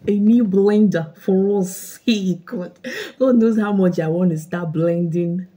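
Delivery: loud, excited gift announcement; jubilant. Real, not acted. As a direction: proclaim it with joyful force. Exclaiming over a new blender.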